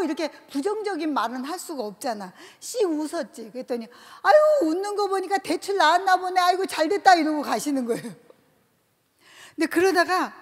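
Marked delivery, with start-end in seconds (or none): 4.2-7.1 cheerful congratulations. Retold or imitated. Acquaintance congratulates her.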